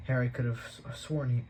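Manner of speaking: plain reading voice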